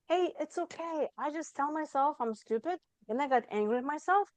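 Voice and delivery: the inner parent comforting a scolded self, tender and high; loving inner parent. Retold or imitated. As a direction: soothe yourself like a kind parent to a child. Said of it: "the inner parent was just like".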